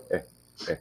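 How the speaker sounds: soft short "eh"; schwa practice. Shown as a demonstration. Teacher showing how to practice "eh". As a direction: repeat a tiny "eh". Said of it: "Just walk around and go".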